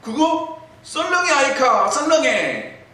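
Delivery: 경상도 사투리로 즉각 대답; quick Gyeongsang answer. Retold or imitated. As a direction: fire back at once in Gyeongsang dialect. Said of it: "즉각 이야기해".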